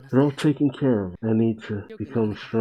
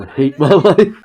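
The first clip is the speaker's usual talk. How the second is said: laughing loud whine; 5 semitones higher, 11 dB louder than usual. Acted. Whining about the poison pain.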